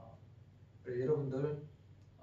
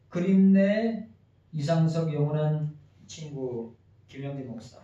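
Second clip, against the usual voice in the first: formal memorial letter read aloud, louder and higher; eulogy reading; 4 semitones higher, 11 dB louder than usual. Acted. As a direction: read out a farewell letter to a late friend, projected and steady. Reads a memorial letter to a friend. (고인이 된 친구에게 바치는 조사 낭독)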